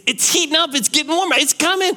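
mock urgent reassurance; pleading parent.

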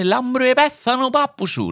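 cheerful singsong Cypriot Greek; cypriot greek accent. Shown as a demonstration. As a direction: say it brightly in a Cypriot lilt. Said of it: "Στα κυπριακά σου λένε".

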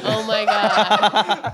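slow laughing "Oh my God". Real, not acted. Listener reacting to the cake story, laughing. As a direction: laugh it out slowly.

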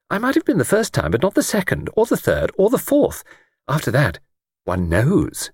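put-on high, eager elderly lady voice; chatty old lady. Old lady on the train chatting to Luke, high elderly voice. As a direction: play a talkative old lady delighted by a stranger.

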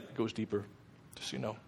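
a hint of God answering gently, low. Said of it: "And he's like".

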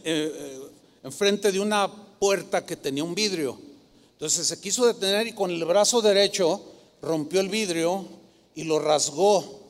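usual speaking voice

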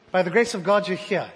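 ordinary talk

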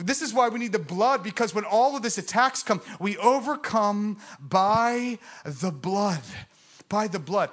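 loud, slow proclamation of the blood; fervent preaching. Acted. Preacher rallying his church, loud and slow. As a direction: drive it home to the congregation.

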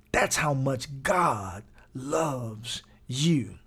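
very slow, word by word; drawn-out, weighty declaration; slow emphasis. Real, not acted. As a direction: stretch out each word with long pauses.

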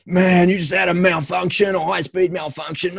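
high, excited mate voice; mate's alarmed news. Retold or imitated. Mate Paul tells him he just had a malfunction, high and excited. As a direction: blurt it out like a shocked army mate. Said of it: "and he's like".

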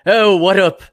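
put-on loud, high monocled-gent greeting in British accent; mock British. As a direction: greet them in a hammed-up English accent. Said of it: "[name] would come in".

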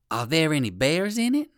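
ordinary delivery

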